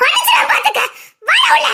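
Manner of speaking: very high, shrieking plea; panicked scream. Acted. Wife screaming at son to come inside, high panicked shriek.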